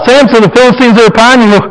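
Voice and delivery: high, mock-urgent cry of warning; mock Delilah alarm. Delilah warns sleeping Samson, high mock alarm.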